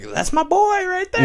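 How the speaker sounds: high, slow, put-on proud parent voice; proud dad. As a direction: beam like a proud father. Imitating proud parents at show.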